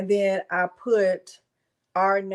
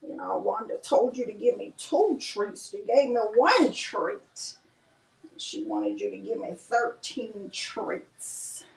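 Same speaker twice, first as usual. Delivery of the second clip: dog's voice. Pretend. Speaking as the dog.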